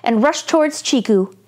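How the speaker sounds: put-on lively read-aloud for children; story narration. A narrator's reading. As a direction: tell it brightly to young listeners.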